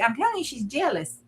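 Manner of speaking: ordinary talk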